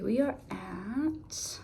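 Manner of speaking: slow, hushed whisper; whispered aside. Real, not acted. Reader announcing the next sign, Capricorn, in a whisper. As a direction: breathe the sign name out quietly.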